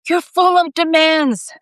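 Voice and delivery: high, slow exasperated whine; loud complaint. A narrator's reading. James grumbles at one last demand, loud high complaint. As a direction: complain loudly, stretching the words out. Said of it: "James complained one last time".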